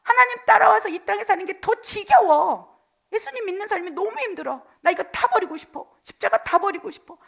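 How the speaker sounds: high, loud bitter outburst; angry complaint. Acted. Backslider shouting resentment at God, angry complaint. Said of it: "다시 분노하고 이스라엘 백성처럼 원망하고".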